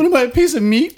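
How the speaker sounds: put-on imagined girl protesting; mock offended. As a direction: play it offended, laughing.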